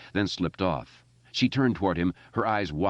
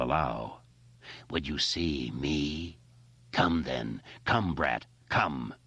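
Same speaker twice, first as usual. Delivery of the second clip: lower, softer menacing entity voice; ancient evil creature; 4 semitones lower, 4 dB quieter than usual. Acted. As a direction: loom over the boy with cold contempt. It speaks from the void.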